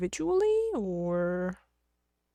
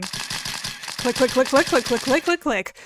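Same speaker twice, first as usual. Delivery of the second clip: camera click noises; slower than usual. Acted.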